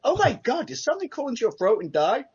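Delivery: very high, loud put-on dismay; mock outrage. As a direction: squawk the question in exaggerated shock. Acted disgusted Simpsons question.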